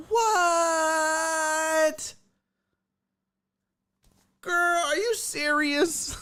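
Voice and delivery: drawn-out high squeal of disbelief; incredulous high-pitched retold reaction; shocked disbelief. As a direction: squeal it high and stretched, mock-stunned.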